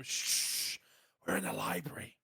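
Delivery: hushed, breathy shush; shushing whisper. Acted. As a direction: whisper it like a librarian. Shushing players in a library.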